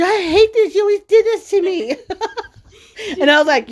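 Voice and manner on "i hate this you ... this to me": very high, loud mock whine; laughing protest. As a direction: imitate a daughter giving in to giggles. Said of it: "And she starts laughing".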